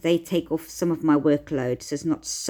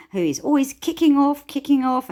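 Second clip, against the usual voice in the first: higher and louder, worked up; exasperated; 9 semitones higher, 3 dB louder than usual. Real, not acted. Parent describing a teen who keeps kicking off: exasperated.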